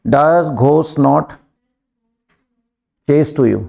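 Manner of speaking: drawn-out words for the class to repeat; slow dictation. Shown as a demonstration. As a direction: stretch each word so they can echo it. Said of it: "repeat करो".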